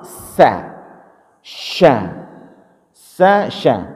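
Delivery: "Sa" at the front, "Sya" from the middle of the tongue; sin versus shin. Shown as a demonstration. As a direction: alternate "Sa" and "Sya" clearly. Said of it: "kalau sin itu di depan". (bedakan "Sa" dan "Sya")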